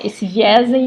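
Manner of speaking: usual speaking voice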